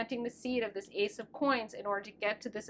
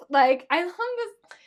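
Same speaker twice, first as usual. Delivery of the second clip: gushing delight; 7 semitones higher, 9 dB louder than usual. Real, not acted. Gushing over the card.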